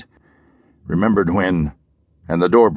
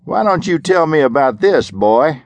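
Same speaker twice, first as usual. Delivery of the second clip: higher, louder call from a distance; sheriff calling over; 8 semitones higher, 3 dB louder than usual. Acted. Sheriff calling to Parker from the street, raised voice. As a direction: call across the yard, lightly taunting. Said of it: "the voice said".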